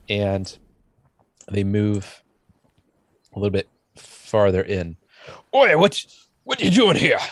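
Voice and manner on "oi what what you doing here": higher and louder, a rough challenge; suspicious bandit. Acted. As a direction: bark at the stranger who walked into camp. GM voices bandit.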